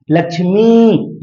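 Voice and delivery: लक्ष्मी said slowly, final ई stretched long; long ई drawn out. Shown as a demonstration. Teacher showing students the long ई, slow and stretched. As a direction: drag out the ई in लक्ष्मी. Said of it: "उच्चारण में ज्यादा समय लग रहा है".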